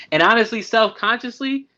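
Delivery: ordinary talk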